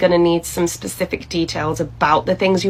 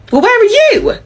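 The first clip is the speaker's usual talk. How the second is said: incredulous; 14 semitones higher, 4 dB louder than usual. Acted. Manager shocked both staff absent.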